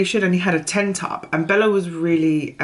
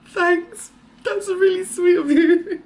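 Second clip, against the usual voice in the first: much higher, teary laugh-cry, slow; choked up; 12 semitones higher, 3 dB louder, slower than usual. Retold or imitated. Mother thanking the barista while choked up, crying. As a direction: thank him through tears and a laugh. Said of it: "I was immediately choked".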